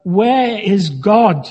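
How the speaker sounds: slow, hammed-up booming bellow; mock bellow. A narrator's reading. Minister bellowing at the boy, mock booming bellow. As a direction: boom it at the boy like a huge minister. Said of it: "raised his voice even more ... bellowed".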